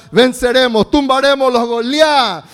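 loud triumphant shout; preacher roaring a war cry of faith; battle cry. Acted. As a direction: shout victory over the giants.

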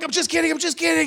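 loud, high retold reassurance; hasty backpedal. Pastor calming screaming girl, loud backpedal. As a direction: call it out over her screaming.

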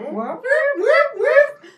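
high, loud siren whoops; alarm whoop. Acted. Imitating an alarm.

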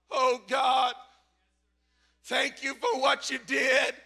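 ordinary delivery

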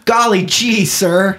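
put-on very high, wide-eyed boy; wholesome 1950s kid. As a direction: gush like a polite 1950s kid. Old-timey kid jokes.

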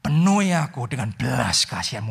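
model prayer. Shown as a demonstration. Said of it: "ngomong dalam hati saudara kepada Tuhan". (doa yang pelan dan rendah hati)

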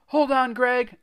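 high, loud urgent cry. A narrator's reading. Narrator urges trapped Greg on, crying out. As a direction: cry out to a friend in danger. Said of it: "I cried".

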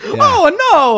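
high-pitched cartoon cry of dismay; Mario character voice. Host introducing himself with a Mario character's mock dismay. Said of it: "another voice from a Mario character".

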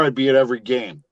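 ordinary delivery

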